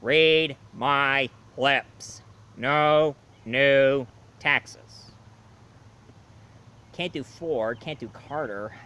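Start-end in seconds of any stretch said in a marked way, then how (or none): 0.0-5.1 very slow, loud, deliberate presidential drawl; George Bush impression. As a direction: spell out each word like a stump-speech promise. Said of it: "Jeff Bush impression".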